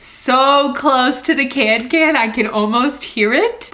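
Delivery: loud, high, drawn-out excitement; giddy excitement. Real, not acted. Reader nearly at the awaited scene, giddy excitement.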